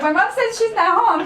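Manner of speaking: put-on high, loud little-kid fib; a child lying to the landlord for mom, high and loud; child fibbing at the door. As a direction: pitch it up like a kid covering for mom. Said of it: "We'll go outside and be like".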